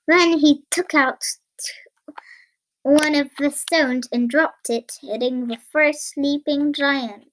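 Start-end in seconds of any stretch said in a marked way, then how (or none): none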